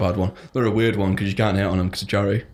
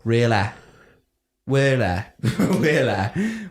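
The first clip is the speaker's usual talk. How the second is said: mock East Yorkshire really; 3 semitones higher, much slower than usual. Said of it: "Imagine if I kept saying that in your ear".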